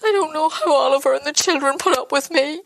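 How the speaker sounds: pushing words through tears; tearful. Acted. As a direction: voice still high after crying. Still upset after crying.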